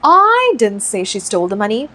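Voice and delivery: slow demo sentence with heavy emphasis on "I"; stress on I. Shown as a demonstration. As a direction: lean hard on the opening "I". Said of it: "I पे focus डालते हैं".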